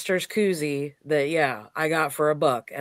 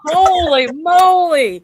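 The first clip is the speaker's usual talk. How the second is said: surprised; 11 semitones higher, 10 dB louder, slower than usual. Real, not acted. Amazed at the road trip.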